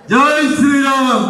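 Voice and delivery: slow, drawn-out rally cry; slogan shout. Real, not acted. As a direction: call it out for the crowd to answer. Rousing crowd to answer louder. (నినాదం సాగదీసి అరవడం)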